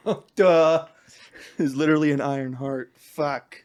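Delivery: loud laughing "oh duh"; laughing realization. Retold or imitated. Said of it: "And I went".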